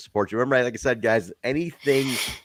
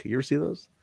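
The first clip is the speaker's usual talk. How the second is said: ordinary talk, nothing marked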